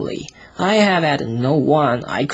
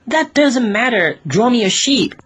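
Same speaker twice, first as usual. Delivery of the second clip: put-on much higher small boy voice; little boy brushing it off; 9 semitones higher than usual. Little prince answering the cross pilot, high childlike voice. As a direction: wave away the excuse like a child. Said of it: "but he replied".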